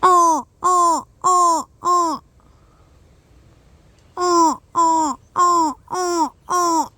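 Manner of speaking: loud cawing like a crow; crow caw imitation. Shown as a demonstration. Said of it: "I'm trying to mimic the crow".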